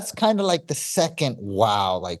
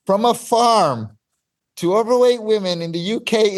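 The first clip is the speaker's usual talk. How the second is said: retold excitement; 7 semitones higher than usual. Retold moment of sudden inspiration.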